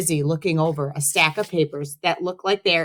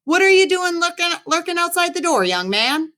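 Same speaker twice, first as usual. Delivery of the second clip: a squeaky, much higher version of the principal; 13 semitones higher, 3 dB louder than usual. A narrator's reading. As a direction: try him as a small, piping voice first. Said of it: "he asked in his big, booming voice".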